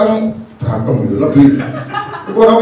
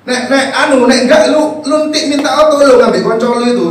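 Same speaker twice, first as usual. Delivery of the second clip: ordinary delivery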